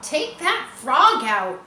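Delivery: loud, slow yell at her brother. A narrator's reading. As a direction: shout it out, drawn out. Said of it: "yelled Fern".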